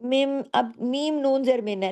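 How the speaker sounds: ordinary talk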